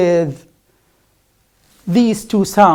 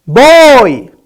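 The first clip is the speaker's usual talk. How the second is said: "Boy!" yelled very loud and higher, with a voiced b; shouted boy; 6 semitones higher, 13 dB louder than usual. Shown as a demonstration. Teacher shouting boy at the paper to show it won't move. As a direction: belt out "Boy!" at full volume. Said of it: "Even if I shout".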